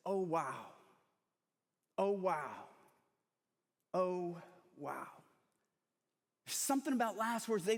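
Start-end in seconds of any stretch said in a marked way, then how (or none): 0.1-5.1 low, hushed-awe retelling; understated wonder. Retold or imitated. Retelling Steve Jobs' last words, understated wonder. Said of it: "there's just times of wonder".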